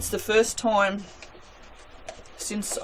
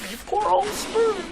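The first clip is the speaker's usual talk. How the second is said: a much higher, cooing mock-pity voice; silly high voice; 13 semitones higher than usual. Soap maker pitying a spoon, in a silly high voice. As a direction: coo at the spoon in a squeaky voice. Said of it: "Sound like an idiot".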